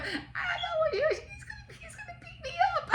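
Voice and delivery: very high squeaky fake panic, laughing, fast at the end; a hammed-up frightened shriek; mock terror. The woman imagined fearing the boss, mock panicked.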